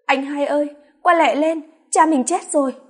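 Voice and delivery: loud, high wailing call; crying loudly. A narrator's reading. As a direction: wail the news across the yard. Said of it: "Linh đã khóc lớn". (khóc lớn gọi anh)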